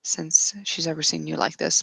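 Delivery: usual speaking voice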